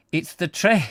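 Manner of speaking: high, hammed-up Scots; Scottish accent attempt. Reader practises husband's train line, put-on Scottish accent. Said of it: "Just get into the Scottishness".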